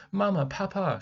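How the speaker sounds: high, loud cry of Mama, Papa; crying for parents. A narrator's reading. Captured girl crying out for her parents. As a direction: cry out for help. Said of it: "she cried".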